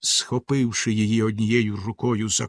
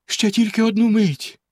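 much higher, begging; pleading; 9 semitones higher than usual. A narrator's reading. Wife begs Bluebeard for one moment, pleading. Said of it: "благально мовила дружина".

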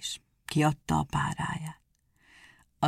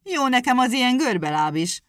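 higher, louder offended retort; angrily; 6 semitones higher, 5 dB louder than usual. A narrator's reading. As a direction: snap back, stung about his legs. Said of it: "mondta haragosan".

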